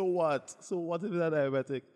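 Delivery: doctor scoffing at a worried diabetic, loud; doctor's loud retort. Retold or imitated. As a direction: bark it back at the patient.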